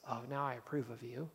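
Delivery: a hint of a grudging God who changes his mind; grudging approval. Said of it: "that God goes".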